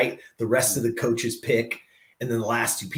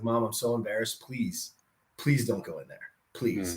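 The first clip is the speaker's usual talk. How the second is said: retold tearful pleading; 3 semitones lower, 6 dB quieter than usual. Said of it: "and I was crying".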